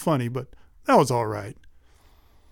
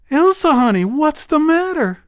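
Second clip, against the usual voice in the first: a mother's alarmed, higher "Elsa, honey"; worried mama; 11 semitones higher, 6 dB louder than usual. Acted. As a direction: coax a crying child with gentle worry. Mother finds daughter crying.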